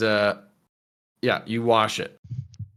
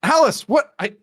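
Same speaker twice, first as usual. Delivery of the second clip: high, incredulous telling-off; stammering outraged disbelief; shocked scolding; 12 semitones higher than usual. Acted. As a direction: demand an explanation, pitch leaping up. Tina confronts Alice over deception.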